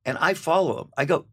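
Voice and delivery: ordinary delivery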